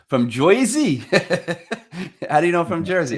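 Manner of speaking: laughing high and loud in surprise. Real, not acted. Guest laughing at the host, high and loud. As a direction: crack up at the mistake.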